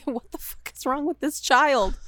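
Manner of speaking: high, loud appalled parent; horrified parent. Pretend. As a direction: gasp it out in parental horror.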